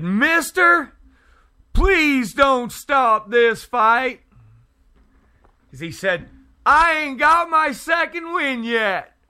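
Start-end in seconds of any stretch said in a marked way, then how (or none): 0.0-4.2 high, loud, drawn-out mimicked boy's plea; littler boy. Littler boy asking a man not to step in, high mimicked plea. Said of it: "the littler boy said". 6.6-9.1 very high-pitched imitation of a fighting boy; littler boy. Littler boy explaining himself to the passerby, high mimicked voice. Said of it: "the littler boy said".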